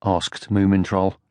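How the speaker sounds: ordinary delivery, nothing marked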